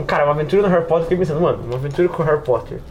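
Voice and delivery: ordinary delivery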